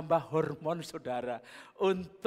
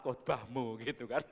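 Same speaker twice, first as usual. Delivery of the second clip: laughing; 5 semitones lower, 4 dB quieter than usual. Real, not acted.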